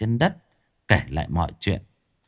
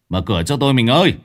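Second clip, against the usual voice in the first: louder call, pounding on the door; 5 dB louder, faster than usual. Acted. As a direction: shout through the door. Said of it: "gọi to". (gọi to ngoài cửa)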